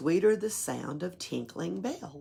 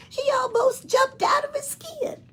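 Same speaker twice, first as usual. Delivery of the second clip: much higher, louder, fast mocking voice; tiny ornament man; 6 dB louder than usual. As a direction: tease him like a tiny mischievous ornament.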